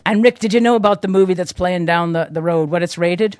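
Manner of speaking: loud, high pirate voice. Pretend. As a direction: ham it up as a pirate. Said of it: "talk like a pirate".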